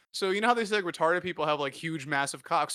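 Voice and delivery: ordinary talk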